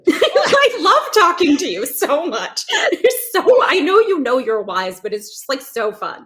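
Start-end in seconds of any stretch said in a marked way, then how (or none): 0.1-5.0 real delighted laugh, high and loud; gushing through laughter. Real, not acted. Guest telling the host she loves talking to her, laughing delightedly. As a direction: gush loudly while laughing.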